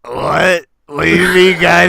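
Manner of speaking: loud mock impressionist, bumbling into the bit; hammed-up parody of a bad impressionist; Andy. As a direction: play a clueless impressionist at full volume. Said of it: "an impression of Andy doing an impression".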